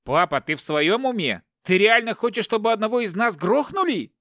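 high, alarmed yelling; outraged shout. A narrator's reading. Captive son challenges his father, shouting. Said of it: "закричал Игорь".

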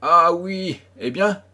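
abrupt, eager ah oui; sudden recollection. Acted. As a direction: burst out as it clicks. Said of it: "Mais brusquement".

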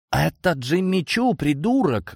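high-pitched outraged snap; angry bark. A narrator's reading. Maid scolds the cat over her clawed shoe, barking angrily. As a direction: bark it indignantly, pitch jumping up. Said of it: "горничная рявкнула так".

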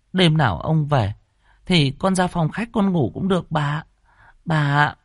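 high, whiny frightened begging; pleading. A narrator's reading. Maid begs mistress to sleep upstairs, pleading. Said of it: "Nó van xin".

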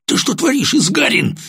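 strained, very high-pitched challenge; tense voice with anger about to break; tense, foreboding storm. A narrator's reading. Lieutenant to sergeant who struck the courier, tense. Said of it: "тихим напряжённым голосом, предвещающим бурю".

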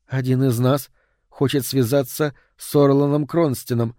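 usual speaking voice

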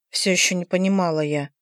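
plain reading voice

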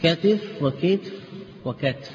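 كتف said katif, then kitf, then katf; كتف said slowly in its three forms; كتف بثلاث لغات; three vowelings of katif. Shown as a demonstration. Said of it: "يجوز فيه ثلاث لغات".

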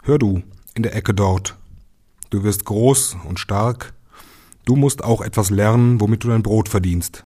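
a father scolding his son from across the room; stern father. Acted. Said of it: "dass der Vater einmal zu ihm sprach".

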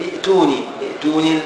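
ائتوني said as itūnī, the second hamza turned to a long ya; ائتوني with no glottal stop before the t; ائتوني بياء ممدودة بدل الهمزة الساكنة. Shown as a demonstration. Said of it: "تقول ايتوني ولا تقول ائتوني".

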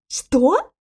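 puzzled, incredulous question; taken-aback query; bewildered. A narrator's reading. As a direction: ask back in bewilderment. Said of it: "в недоумении переспросил".

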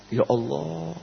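soft, drawn-out sigh to God; humble prayer. Acted. Preacher acting the insulted person turning to God, humble. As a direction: breathe it out softly and humbly.

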